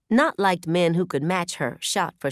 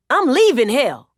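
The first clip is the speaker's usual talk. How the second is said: loud, higher yelled reply; 7 semitones higher, 8 dB louder than usual. A narrator's reading. Man yelling back at his woman as he leaves. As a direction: yell it back at her. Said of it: "he yelled".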